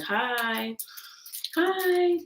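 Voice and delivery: high, soft drawn-out hi; singsong greeting. Real, not acted. Streamer greeting viewers as they join, high singsong. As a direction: hold the hi high and light.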